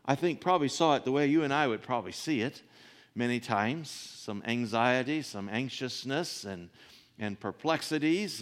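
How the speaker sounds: usual speaking voice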